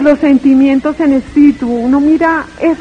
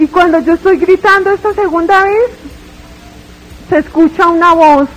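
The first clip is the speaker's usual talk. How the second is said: usual speaking voice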